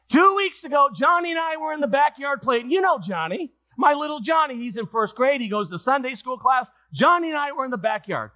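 high, eager storytelling voice; excited lady telling. Acted. Lady explains the cat to her pastor, high and excited. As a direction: pitch it high and gush to the pastor.

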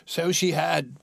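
quick emphatic agreement; crying out. A narrator's reading. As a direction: cry it out eagerly. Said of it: "cried Scrooge".

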